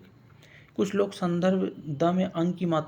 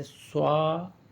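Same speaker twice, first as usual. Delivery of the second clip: स्वातंत्र broken as "swaa... tantrye"; long pause inside स्वातंत्र after a stretched swaa; sounding it out; slower than usual. Shown as a demonstration. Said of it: "कुछ इस तरह से".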